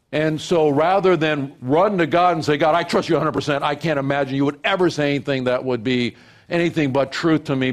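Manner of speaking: loud, high, driving sermon delivery; preaching at full force. Real, not acted. Pastor preaching on temptation, loud and driving. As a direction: preach it out with fire.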